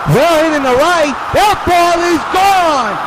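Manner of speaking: home run call. Pretend. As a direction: build it up as the ball flies. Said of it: "the home run call".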